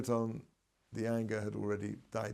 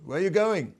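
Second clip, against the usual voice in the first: raised, higher calling-out; shouted call; 6 semitones higher, 6 dB louder than usual. Retold or imitated. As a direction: call out after someone running off. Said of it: "his grandmother shouted out to them".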